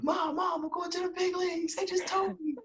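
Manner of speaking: very high, squealed news to mom; excited announcement. Retold or imitated. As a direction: reenact bursting with big news. Breaking the news to his mom.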